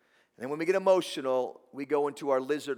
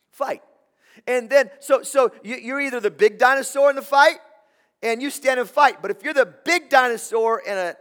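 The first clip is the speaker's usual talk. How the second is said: loud, high, driving sermon voice; fired-up preacher climbing in pitch; preaching at full force; 7 semitones higher, 10 dB louder than usual. Acted. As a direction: build it to a full pulpit shout.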